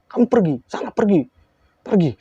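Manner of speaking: high, falling repeated commands; imitated frantic shooing away; acted outburst. Possessed woman orders teller away, imitated hysterical commands.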